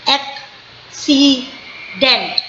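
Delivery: "accident" split into ac-ci-dent, very slow; slow teaching demo of "accident"; syllable by syllable. Shown as a demonstration. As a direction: beat out each syllable of "accident" for the class. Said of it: "it is going three times down".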